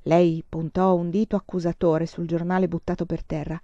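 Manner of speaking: ordinary delivery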